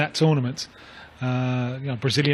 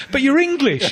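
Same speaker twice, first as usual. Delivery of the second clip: high, loud mock astonishment, laughing; incredulous imitation through laughter; amused disbelief; 12 semitones higher, 5 dB louder than usual. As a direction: retell his amazement, pitched way up. Retelling footballer's amazed reply.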